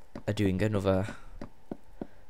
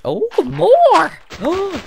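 much higher, louder gasp of joy; excited shriek; 12 dB louder, much slower than usual. Real, not acted. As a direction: shriek with delight at the find. More clay, shrieks again.

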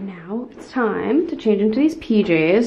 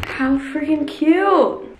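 delighted; 5 semitones higher, much slower than usual. Real, not acted. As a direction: gasp then slow gushing delight. Admiring pajamas.

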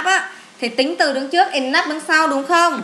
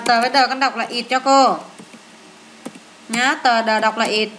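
ordinary talk, nothing marked

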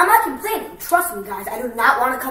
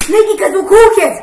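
playful; 10 semitones higher, 12 dB louder than usual. Real, not acted. Playful boast.

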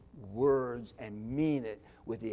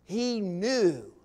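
normal talking voice

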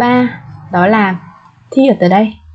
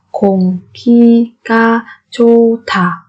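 very slow drill; much slower than usual. Shown as a demonstration. Teacher drilling learners very slowly, syllable by syllable. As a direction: stretch every syllable of Không khí ga chô thả.

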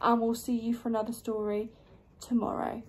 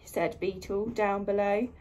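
plain reading voice

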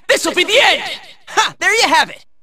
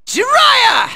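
high battle call; summoning shout; 6 semitones higher than usual. Acted. Hero calling Jiraiya to fight, high shout. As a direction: belt out the name, pitched high.

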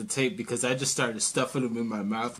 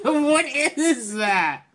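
laughing; 10 semitones higher, 3 dB louder, much slower than usual. Real, not acted. Laughing at bird drawing.

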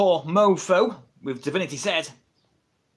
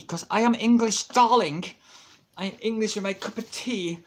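higher, hammed-up English accent; mock English accent, pitched up; exaggerated English accent; 6 semitones higher than usual. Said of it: "I don't actually talk like that".

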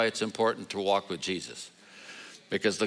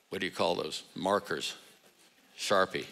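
normal talking voice

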